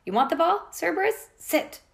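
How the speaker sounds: high, loud dog-training shout; commanding shout at a monster dog. Acted. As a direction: call to a huge dog like a trainer. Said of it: "She shouted".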